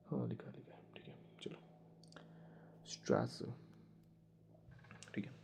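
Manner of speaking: under-the-breath muttering to self; whispered self-talk; whispered aside. Real, not acted. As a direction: murmur while checking the notes. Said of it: "muttering while checking notes".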